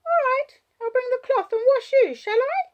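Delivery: put-on high, loud little-girl voice; child's voice. A narrator's reading. Child offering to wash hurt Wallace, high child voice. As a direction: offer help as an eager-to-help small child.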